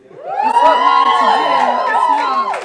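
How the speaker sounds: very high, loud squeal; shrieked. Acted. Comic scolding the audience about pronouns, high loud squeal. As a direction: squeal it in exasperation.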